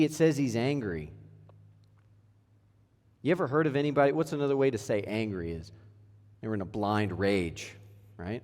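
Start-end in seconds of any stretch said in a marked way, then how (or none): none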